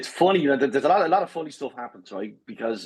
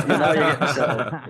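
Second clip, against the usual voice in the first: real laugh breaking into the words; laughing explanation. Real, not acted. Storyteller explains Dublin joke to hosts, laughing. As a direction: talk through the laughter.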